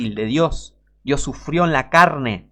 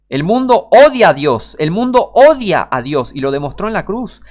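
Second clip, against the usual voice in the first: much louder and higher, hammering the words; preaching shout; 5 semitones higher, 8 dB louder than usual. Real, not acted. Preacher declaring to the congregation, full-voiced shout. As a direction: proclaim it at full force from the pulpit.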